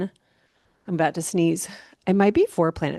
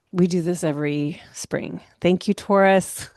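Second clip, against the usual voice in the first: ordinary talk, nothing marked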